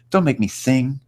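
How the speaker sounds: high, theatrical drama queen plea. Retold or imitated. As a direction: ham it up like an old theater diva. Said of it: "the old drama queen, the theater lady".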